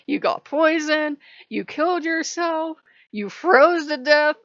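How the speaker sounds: high, loud incredulous questioning; loud, worked-up disbelief; exasperated rant. Retold or imitated. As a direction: rattle off the failures in mock outrage. Acts out frustration at game.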